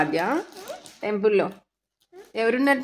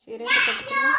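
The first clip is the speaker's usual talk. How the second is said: much higher, mock-amazed asking; playful high question; 13 semitones higher than usual. Real, not acted. Mother teasing her toddler about wearing a saree, asking in a much higher voice.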